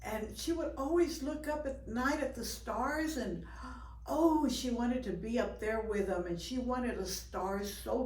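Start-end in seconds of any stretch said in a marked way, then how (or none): 3.5-4.0 quick breathless gasp of wonder; unvoiced little gasp of longing; soft gasp. A narrator's reading. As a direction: catch your breath in awe. Raccoon girl yearning for stars.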